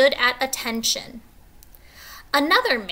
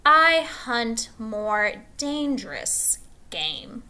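slow, measured menace; a general savouring his secret, slowly; 2 semitones higher, slower than usual. Acted. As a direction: draw each word out slowly. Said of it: "he said in a slow tone".

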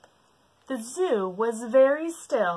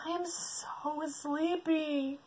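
slow, soft, drowsy; sleepy; 8 semitones higher, 6 dB quieter, slower than usual. Acted. Elephant nearly asleep, slow and soft. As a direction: fade into sleepiness.

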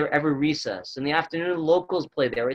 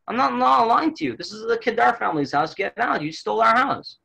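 higher-pitched acted accusation; retold indignant protest; 6 semitones higher than usual. Returning owner confronts man in his house, retold indignation. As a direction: push the pitch up as if arguing your claim.

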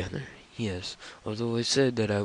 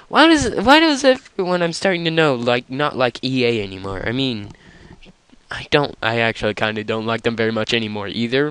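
annoyed rant; 2 semitones higher, 11 dB louder than usual. Real, not acted. Loud rant about disliking EA.

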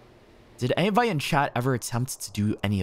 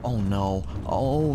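normal talking voice

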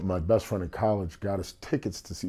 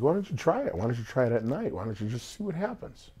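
lightly voiced sister, pitched up; coaxing sister; 6 semitones higher than usual. Sister urging her brother to try singing, coaxing. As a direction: imitate a sister nudging you, higher.

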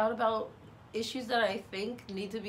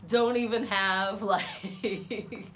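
laughing; 5 dB louder, much slower than usual. Real, not acted. Laughs at her own small following.

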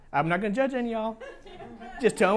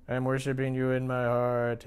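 low, droning, listless monotone; half-hearted worshipper; 9 semitones lower, 7 dB quieter than usual. Acted. Worshipper claiming to worship God in his heart, half-hearted. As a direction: drone it out dully, much lower and softer.

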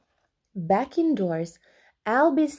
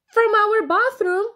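shocked; 12 semitones higher, 7 dB louder than usual. A narrator's reading. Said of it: "he said".

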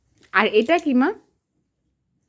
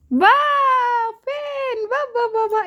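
high, drawn-out cheering; excited praise; 9 semitones higher, slower than usual. Real, not acted. Mother cheering her toddler's first word, high and excited.

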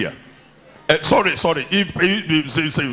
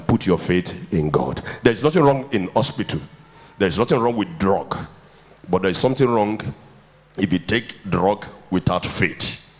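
low solemn preaching; 10 semitones lower than usual. Acted. Solemn teaching on faith.

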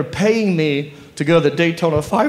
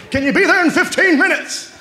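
high agent voice; 13 semitones higher than usual. Retold or imitated. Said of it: "I was like".

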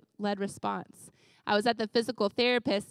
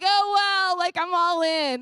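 mock enthusiasm; 6 semitones higher, 5 dB louder, slower than usual. Mock enthusiastic all-in quote.

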